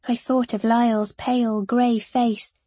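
ordinary delivery

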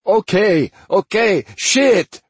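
loud, high cries of surrender; panicked giving-in yell. Acted. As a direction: cut in loudly, desperate to make it stop. Demir gives in under violent threat.

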